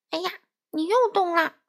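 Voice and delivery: story-voice yell of annoyance; exasperated yelling. Acted. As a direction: scold the duck for moving again. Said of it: "佛洛格嚷嚷着".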